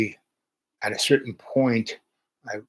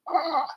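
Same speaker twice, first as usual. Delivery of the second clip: mock dismayed gasp. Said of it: "I'm a triple Virgo".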